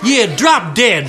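slow, drawn-out bratty insult; slow rude insult. Acted. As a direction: stretch it out to annoy. Second girl drawls again.

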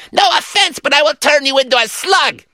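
very high, pinched menace; high squeaky villain. Acted. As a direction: squeak out the threat. Acting out the play scene.

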